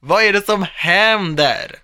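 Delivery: loud, high, drawn-out question; slow, emphatic playful demand; mock interrogation. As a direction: stretch every word, hammed up. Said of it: "jag blir så här".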